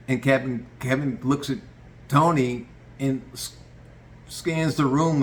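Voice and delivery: ordinary talk, nothing marked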